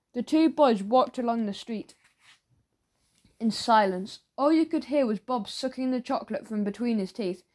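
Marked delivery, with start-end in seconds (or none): none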